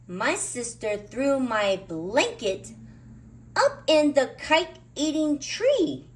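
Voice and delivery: rising high and loud, slow; distressed cry. A narrator's reading. Linus cries out after his blanket is eaten, distressed. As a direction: cry out in shock.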